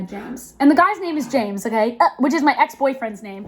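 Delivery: ordinary talk, nothing marked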